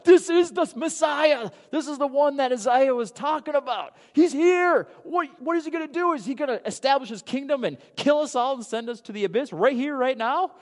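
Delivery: preacher voicing the demons recognizing the Messiah in panic; panicked demons. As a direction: rush it like terrified demons. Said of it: "The demons were like".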